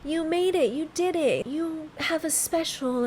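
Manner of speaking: high, emotional imagined message; emotional affirmation. Acted. Telling her twenty-year-old self good news, emotional affirmation. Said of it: "I wanna tell her".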